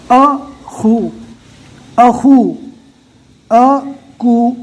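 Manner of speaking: اخو and اکو said slowly, each syllable held apart; syllable spelling demo. Shown as a demonstration. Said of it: "اس طرح ہجے کرنا ہے".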